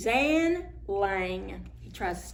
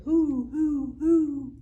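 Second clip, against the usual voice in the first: hooting owl; 6 semitones higher, 5 dB louder, much slower than usual. Acted.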